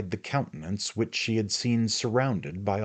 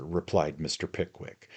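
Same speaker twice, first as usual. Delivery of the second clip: ordinary delivery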